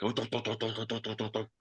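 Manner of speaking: quiet mock muscle-car engine chugging; imitated engine rumble. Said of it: "kinda sounds like an old muscle car".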